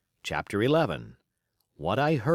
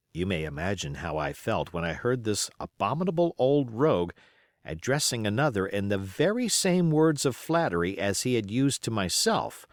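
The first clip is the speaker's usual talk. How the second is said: ordinary delivery, nothing marked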